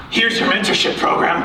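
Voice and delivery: ordinary talk, nothing marked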